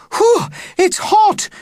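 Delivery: very high, loud slow cry; cried out. Acted. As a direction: cry out at the heat. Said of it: "cried someone".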